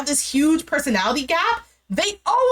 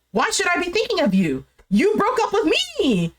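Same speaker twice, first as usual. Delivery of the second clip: high, indignant imitation of an arguing ex; indignant retort; 5 semitones higher than usual. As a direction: act out an incredulous comeback, pitched up and stretched at the end. Acted quarrel with an ex.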